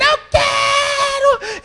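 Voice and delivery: high, slow wailing child, mock shout; screaming child. Preacher imitating the boy screaming in line. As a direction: drag it out like a bawling kid. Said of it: "o menino gritando".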